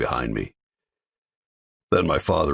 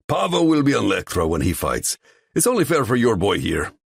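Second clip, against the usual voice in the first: higher, louder mocking voice; smug rival; 9 semitones higher, 4 dB louder than usual. [name] mocks the father, higher and smug. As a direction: needle them with fake fairness.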